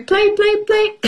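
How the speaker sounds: put-on climbing high childish play play; childish whine. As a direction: mimic kids begging to play. Mimicking whiny children.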